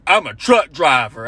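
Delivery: slow dopey mock voice; dim husband. Comic mocking the trucker husband, slow and dopey. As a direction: plod through it like a dumb trucker. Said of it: "He's like".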